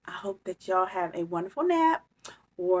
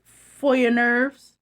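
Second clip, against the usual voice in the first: slow teasing; 5 semitones higher, 9 dB louder, slower than usual. Acted. Bear offers story teasingly.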